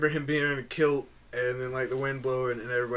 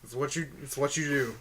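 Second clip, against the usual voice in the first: ordinary delivery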